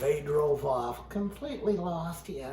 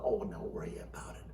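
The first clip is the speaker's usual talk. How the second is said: ordinary talk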